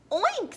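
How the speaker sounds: quick high oink; piggy oink. A narrator's reading. Narrator voicing a piggy, high oink. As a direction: oink like a little pig. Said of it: "said a piggy".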